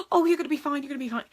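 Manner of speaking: high, loud, gasping fuss; mock panic, way over the top. Shown as a demonstration. Owner fussing over a scared dog, over the top. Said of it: "go O T T on the".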